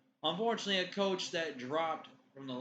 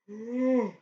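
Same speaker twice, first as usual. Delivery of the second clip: yawning sigh; 9 semitones higher, 11 dB louder than usual. Real, not acted. Yawning again mid-sentence.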